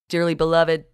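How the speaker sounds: a hint of a preacher; blurted. A narrator's reading. Bill blurting a sermon opening to the crowd, mild. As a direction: blurt it out, mock solemn. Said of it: "he blurted".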